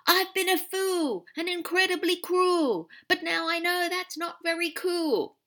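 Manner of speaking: high, loud sing-song recitation; reciting a bad poem to win his mate back; recited verse. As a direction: declaim the rhyme with gusto. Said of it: "the Alpha recited".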